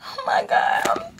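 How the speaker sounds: real high squeaky sob; slow, very high, voice lost to tears; tearful cry. Real, not acted. Streamer nearing eighty thousand subscribers live, tearful cry. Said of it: "Y'all already know I'ma cry".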